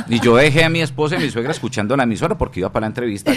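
ordinary talk, nothing marked

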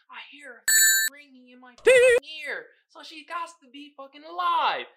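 mock desperate insistence; insistent twin. Twin insisting to everyone her sister lives, loud.

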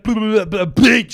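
loud, high-pitched babble; mock blurting. Imitating Trump fumbling scientific terms, mock blurting. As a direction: babble high and loud, then burst out the word.

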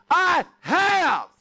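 high, loud, exasperated shout; booming impatient God shouting; God's voice. Retold or imitated. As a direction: bellow it like God fed up. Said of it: "God's like".

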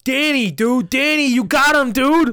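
loud, high hammed-up Boston bro hype; a loud Southie bro egging his pal on in a Boston accent. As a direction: cheer your buddy on in a Boston accent. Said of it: "I can never do, like, a accent".

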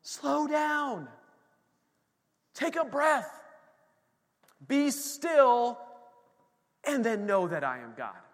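lightly voiced God calming a hurried person; voice of the Lord. God telling the restless believer to slow down. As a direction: Stop starts high, then slow, spaced commands. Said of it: "the Lord is saying".

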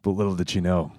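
hardboiled noir narration; brooding private eye voice-over; noir detective. Acted. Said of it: "It's noir".

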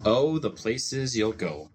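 ordinary delivery, nothing marked

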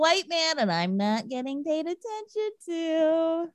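lightly put-on voice, playing the ignored man; mock complaint. Acting out a character's complaint.

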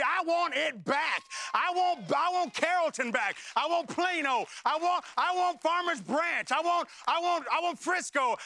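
full-voiced sermon climax; preaching at full force. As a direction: preach it, demanding back what was stolen. Preaching climax, rising to shout.

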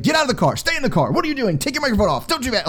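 exasperated high-voiced impression of bossy outbursts; mock frantic nagging. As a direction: imitate her barrage of contradictory orders. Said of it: "I was on edge with her".

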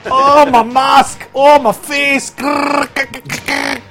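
high, slow anguished villain cry; rasping strained mutant. Acted. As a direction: wail like a scarred comic villain. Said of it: "he's Destro".

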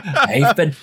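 put-on comic singsong Welsh accent. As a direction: ham up a Welsh Bond. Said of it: "my man has a Welsh accent".

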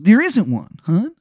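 loud, high, dragged-out Maryland drawl; over-the-top Maryland voice; Shithead Steve's Maryland accent. Acted. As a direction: announce yourself in a horrible Maryland accent. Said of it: "the accent is just".

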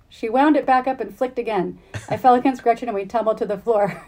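expressive read-aloud of a novel passage; story reading. Acted. The host reads the novel's scene to its author, an animated reading.

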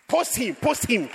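loud, high, slow "post him"; proud church member urging loudly; excited urging. Pretend. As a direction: cheer them on to show him off.

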